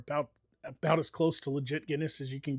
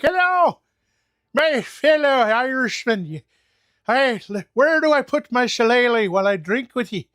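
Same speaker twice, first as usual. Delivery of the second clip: hammed-up stage Irish accent, much higher and louder; mock Irish greeting; 8 semitones higher, 11 dB louder than usual. Mocking a tourist's fake Irish to the viewers, stage Irish accent. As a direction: lay on a cartoon Irish accent, slowing on fellow Irishman. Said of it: "I didn't walk in and be like".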